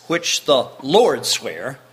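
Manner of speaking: slow, weighty stress on "which the Lord sware", each word drawn out; emphasis on sware. Shown as a demonstration. Preacher stressing God's oath to his congregation, slow and emphatic. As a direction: lean hard on "the Lord sware" and take it slowly. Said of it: "I'm putting emphasis on that".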